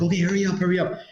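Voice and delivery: a nervous driver rushing them; impatient woman. Retold or imitated. Colombian woman rushing the unloading, impatient urging. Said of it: "She's like".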